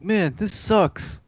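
high-pitched, drawn-out mock complaint; whiny stock character. One-note extra moaning on arrival, mock complaint.

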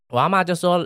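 ordinary delivery

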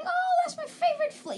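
very high mock fan voice; mocking quote. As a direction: squeal it to mock the fans. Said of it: "People are saying".

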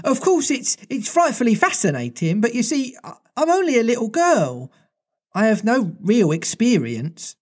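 put-on high, bright little girl voice; Wendy shining. Wendy answers the boys, high and delighted. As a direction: play a flattered little girl. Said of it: "Wendy said, all shining".

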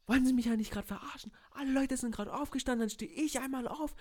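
high, loud indignant comeback; retold outraged retort. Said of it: "Und ich war so". (empört hoch und laut zurückgeredet)

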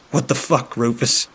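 angry. A narrator's reading. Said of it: "Toggs states angrily".

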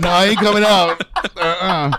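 loud, high comic refusal; stubborn hideaway. Pretend. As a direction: refuse to budge, as a silly character. Voices turtled body part.

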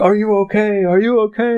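high urgent check on someone; worried police officer. Retold or imitated. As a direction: call out high and anxious into the house. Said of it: "was like".

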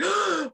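sharp high gasp of alarm; a gasp when caught out; startled gasp. Retold or imitated. Caught character, with a sharp mock gasp. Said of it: "he looks so like".

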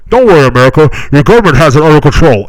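very loud announcer-like mock reassurance. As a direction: blast it out as a sarcastic proclamation to the nation. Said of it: "Bill Hicks used to say".

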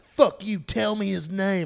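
mock furious demand; angry man. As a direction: snap like an irate father demanding answers. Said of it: "confused and angry".

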